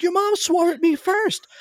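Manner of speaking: high, slow, defensive protest; defensive companion. Retold or imitated. Companion defending herself to the son, high and whiny. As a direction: protest it wasn't your fault, pitched high. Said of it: "She's like".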